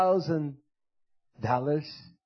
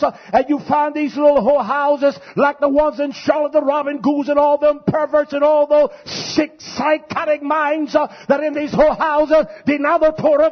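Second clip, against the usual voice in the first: high, loud pulpit thunder; fiery preacher rolling through accusations; full-force preaching; 10 semitones higher, 7 dB louder than usual. Real, not acted. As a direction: preach it hard to the congregation.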